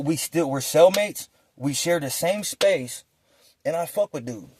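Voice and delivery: usual speaking voice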